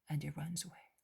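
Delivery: ordinary delivery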